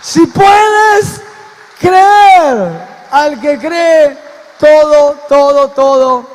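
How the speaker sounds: high, very loud, drawn-out proclamation; full preaching shout. Real, not acted. Preacher urging the congregation to believe, impassioned shout. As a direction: cry out the verse with all your force.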